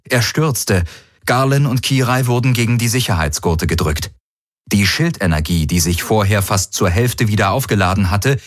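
normal talking voice

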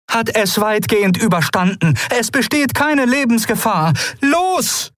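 high, pressing report; urgent. A narrator's reading. Keru tells the crew the hold survived, urgent.

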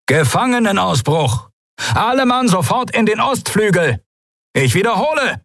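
high shouted alarm over a loudspeaker. Acted. Announcer alerts pirates to prison break, shouted loudspeaker alarm. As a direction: bellow the alarm to the whole base. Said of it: "donnerte ... über versteckte Lautsprecher".

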